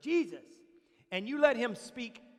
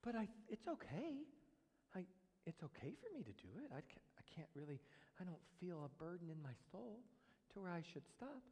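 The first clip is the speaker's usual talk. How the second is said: faint, whispered mock excuse; 5 semitones lower, 20 dB quieter than usual. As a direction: whisper the excuse like a sneaky confession. Mocking a self-excusing sinner.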